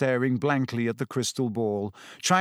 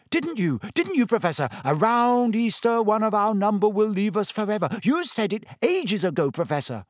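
high, eager, fawning schoolgirl; a pupil flattering her teacher; gushing Lavender; 8 semitones higher than usual. Acted.

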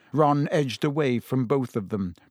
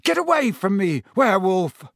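high, urgent gasp of alarm; 5 semitones higher, 4 dB louder than usual. A narrator's reading. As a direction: gasp it out, pitched up and sharp. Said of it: "Ron gasped".

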